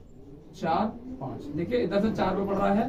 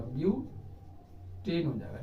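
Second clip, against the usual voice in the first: Butane हो जाएगा drawn out slowly and softer; slow, soft repeat; 3 semitones lower, 6 dB quieter, much slower than usual. Shown as a demonstration. Teacher repeating the compound name for students, slow and soft. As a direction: spell the name out slowly for the class. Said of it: "इसका नाम पूरा".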